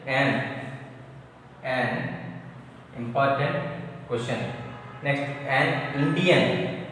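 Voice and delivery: ordinary talk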